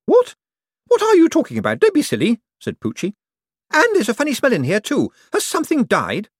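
high, loud haughty woman; Poochie's voice. Acted. To Cosmo, a greedy high-pitched rich woman.

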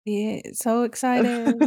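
slow, deadpan sarcasm; sarcastic. Real, not acted. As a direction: drag it out, clearly not excited. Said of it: "you sound excited to be a stay-at-home mom".

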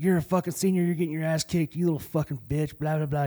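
high taunting rant; a seventh grader mouthing off at the senior; mock taunting kid. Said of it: "I was like".

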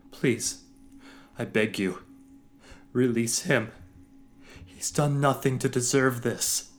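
loud high desperate pleading; pleading in tears. Acted. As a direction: beg through tears. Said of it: "tears streaming down his face".

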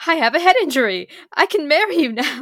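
loud, high-pitched hammed-up proclamation; high, loud retold outburst; mock excited announcement. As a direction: blurt it out like an overjoyed, play-acted suitor. Acting out injured suitor's hopeful proposal.

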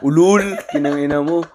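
teasing loud retort; playful loud "Ulul". Real, not acted. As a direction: call out his lie. Friend denying, teasing.

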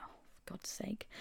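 soft, breathy whispered curse; whispered grumble. Retold or imitated. Kept in hospital, a whispered exasperated grumble. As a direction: grumble it under your breath. Told to stay in again.

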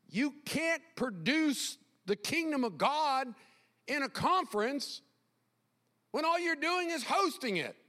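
high, insistent pulpit voice; emphatic preaching. Real, not acted. Preaches at raised pitch.

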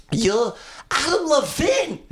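high, slow mock Taiwanese accent; imitated accent. Excited stranger spotting a celebrity, high mock accent.

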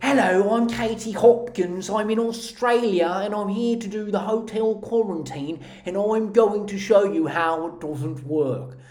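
irritating put-on British accent; mock a self-important British video greeting; exaggerated British impression; Katie Hopkins. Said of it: "irritating British way of talking".

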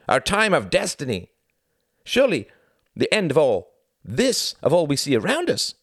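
high-pitched, emphatic nationalist orator; hardened zealot. A narrator's reading. Klaus lectures Tom on Germany's destiny, hardened and fervent. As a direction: press each point with rising fervour. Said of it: "His voice hardened again".

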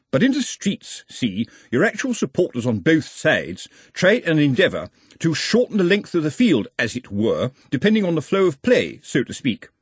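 stiff, high-pitched formal address; awkward public speaker Ottomy. Acted. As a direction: address the gents with laboured formality.

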